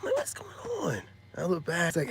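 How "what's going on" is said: very high, soft falsetto reply; high falsetto mock greeting; put-on voice. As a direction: squeak it out in mock innocence.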